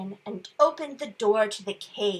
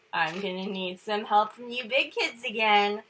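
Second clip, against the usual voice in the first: ordinary delivery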